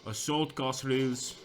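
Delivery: put-on slow, drawn-out Afrikaans accent. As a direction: bark the order in an Afrikaans accent. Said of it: "Try to get a nice Afrikaans accent on that".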